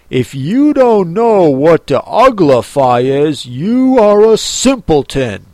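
loud, emphatic scolding; scornful Gryphon. Acted. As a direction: put the listener down forcefully.

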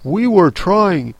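lightly voiced humble servant; mildly humble. A narrator's reading. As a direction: explain meekly to a queen. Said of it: "in a very humble tone".

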